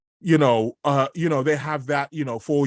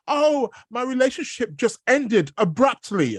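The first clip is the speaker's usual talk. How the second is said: much higher-pitched mock lament; imitated dismayed people; 10 semitones higher than usual. Astrologer quoting people with sudden breakups, high mock dismay. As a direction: imitate someone complaining, pitched right up. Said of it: "but the people are".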